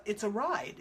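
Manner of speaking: ordinary talk, nothing marked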